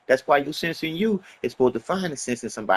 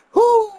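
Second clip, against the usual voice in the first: high, loud whoop with a laugh; exultant whoop; 5 dB louder than usual. Real, not acted. Pumped up after his own sermon, loud whooping laugh. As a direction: let out a joyful whoo.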